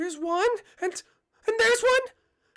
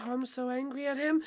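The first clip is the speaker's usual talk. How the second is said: low, hushed grumble of anger; seething anger; 7 semitones lower, 7 dB quieter than usual. Acted. Peach grumbling about Mario, low seething anger. Low take, then raised take.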